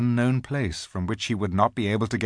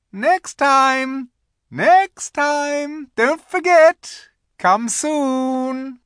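high, loud, drawn-out calls; calling out; 7 dB louder, much slower than usual. A narrator's reading. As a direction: call back from a departing sledge. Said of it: "calling out".

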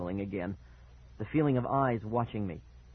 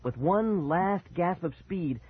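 usual speaking voice

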